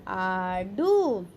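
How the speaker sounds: very slow, each sound held; slow drawn-out word. Shown as a demonstration. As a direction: sound it out for young pupils. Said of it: "spelling drill, slow blended word".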